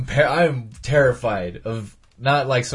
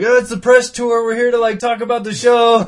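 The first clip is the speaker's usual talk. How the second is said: cheery press voice; 9 semitones higher, 4 dB louder than usual. Retold or imitated.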